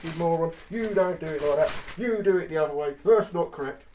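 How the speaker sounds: huffy tradesman telling off a novice; scolding butcher. Retold or imitated. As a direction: play the outraged butcher correcting him. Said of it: "Butchers ... Go".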